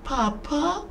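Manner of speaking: high, tentative little call; high questioning voice of a trapped boy; faint distant child. A narrator's reading. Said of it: "faint in the distance".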